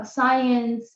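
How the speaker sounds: ordinary talk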